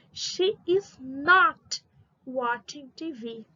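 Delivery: very slow, each word held long; slow modelling. Shown as a demonstration. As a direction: build the negative sentence piece by piece for learners. Teacher builds negative sentence slowly. (frase negativa bem devagar)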